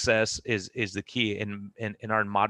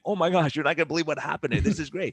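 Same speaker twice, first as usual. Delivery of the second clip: excited gush; 6 semitones higher than usual. Retold or imitated. Telling wife about his day.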